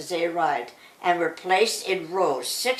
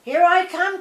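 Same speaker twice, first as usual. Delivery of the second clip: higher, louder, joyful yell; excited cry; 8 semitones higher, 3 dB louder, slower than usual. A narrator's reading. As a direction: whoop it out on the galloping goat. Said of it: "cried Claude Pole".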